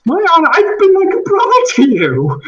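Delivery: indignant, rising to a high loud cry; a wronged man defending his honor, pitched high and loud; outraged denial. Acted. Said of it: "Ethan just stands up and is like".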